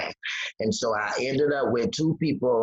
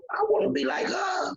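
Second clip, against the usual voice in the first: yearning cry. Retold or imitated. Retold longing to be happy.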